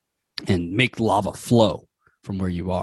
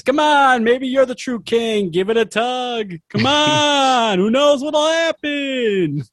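coaxing talking sword rock; 13 semitones higher, slower than usual. Acted. The rock tempting passing critters, high and coaxing. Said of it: "it says".

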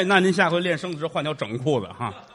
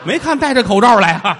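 laughing jab; 6 semitones higher, 8 dB louder than usual. Real, not acted. Mocking laugh line.

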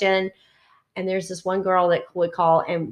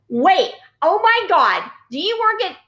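surprised recognition; 10 semitones higher, 5 dB louder than usual. Retold or imitated. Bar girl recognizes her.

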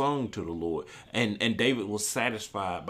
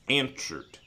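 ordinary talk, nothing marked

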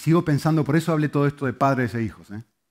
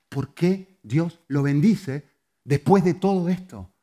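ordinary delivery

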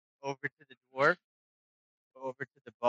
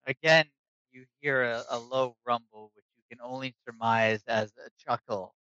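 ordinary talk

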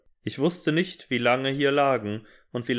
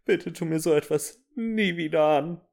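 mock sobbing; 7 semitones higher than usual. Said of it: "schluchzte ich".

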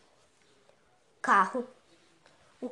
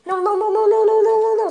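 panicked; 6 semitones higher, 6 dB louder, much faster than usual. Acted. Panics alone in the car.

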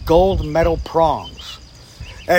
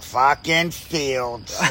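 very slow, drawn-out exasperated groan of a name; exasperated boss; 2 semitones lower, 4 dB quieter, much slower than usual. Retold or imitated. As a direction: drag it out like a weary old boss.

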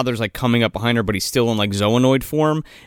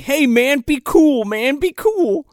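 high-pitched appeasing voice, slowing at the end; mock pleading; 13 semitones higher, slower than usual. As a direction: imitate someone begging a gunman to calm down. Retold Bob begging for calm.

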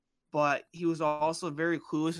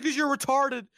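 higher, louder bullying retort; mock scorn; 9 semitones higher, 5 dB louder than usual. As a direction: imitate a bully's scornful comeback.

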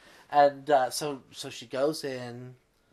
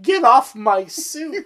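high, loud and slow; mock outraged yell; 13 semitones higher, 12 dB louder, slower than usual. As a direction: snap it out, dragging the words. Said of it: "and he's like".

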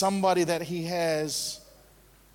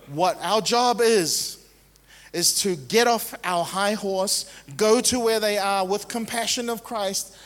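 rising, louder preacher's call, pressing each phrase; preaching at full force; 4 semitones higher, 3 dB louder than usual. Acted. Preacher urging the congregation to action, rising and louder. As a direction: drive the charge home to the church.

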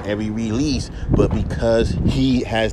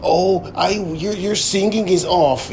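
higher mock critic voice; 8 semitones higher, slower than usual. Rapper mimics fans saying his singing is off, higher and mocking. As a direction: whine it like a know-it-all fan. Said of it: "fans are gonna say".

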